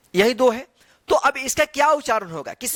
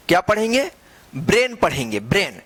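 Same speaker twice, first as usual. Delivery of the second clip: ordinary delivery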